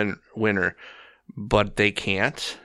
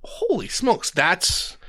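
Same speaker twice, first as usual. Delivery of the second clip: much higher, startled Holy smokes; a quick high burst of wonder; amazed; 9 semitones higher, slower than usual. Retold or imitated. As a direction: jump up in pitch with genuine amazement. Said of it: "And I went".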